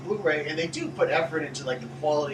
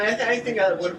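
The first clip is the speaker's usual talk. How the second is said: normal talking voice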